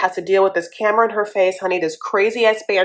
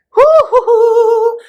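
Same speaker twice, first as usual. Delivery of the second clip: high hooting whoops; 7 dB louder, much slower than usual. Real, not acted. Host hoots as Natalie and Mike appear. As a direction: hoot way up high and loud. Reacting to the next couple.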